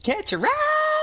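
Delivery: very high, stretched catchphrase; Borderlands character holler. Acted. Player quoting Borderlands to friends, high drawn-out holler. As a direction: squeal the catchphrase out long and slow.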